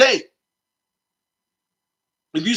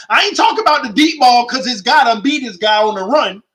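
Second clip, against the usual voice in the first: ordinary talk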